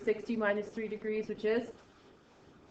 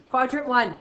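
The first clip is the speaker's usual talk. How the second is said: loud, higher, freely inflected; back in own zone; 4 semitones higher, 10 dB louder than usual. Real, not acted. Teacher restarting with quadrant one, loud and lively. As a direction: break out into full teaching voice. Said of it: "I'm in my own zone again".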